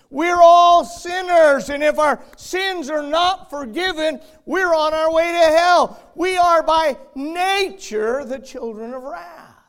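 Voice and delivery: loud, slow, high-pitched fire-and-brimstone preaching; preaching at full force. Acted. Preacher warning the congregation of hell, in full-force preaching. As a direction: thunder each word of warning out over the church.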